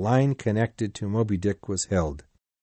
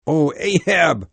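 higher, louder anguished cry; raised, falling exclamation; 6 semitones higher, 5 dB louder than usual. A narrator's reading. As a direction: cry out to your captain in dismay. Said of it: "cried Starbuck".

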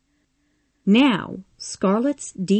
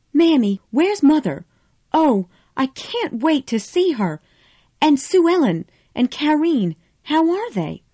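higher, louder eager questions; crying out; 9 semitones higher, 3 dB louder than usual. A narrator's reading. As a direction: pour out the questions breathlessly. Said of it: "Scarlet cried".

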